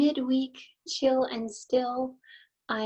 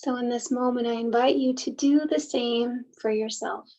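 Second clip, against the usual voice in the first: unhurried meditation guide, long pauses between phrases; guided meditation. Acted. Host leading listeners to soften and settle, guided meditation. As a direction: gently guide listeners inward, leaving space after each thought.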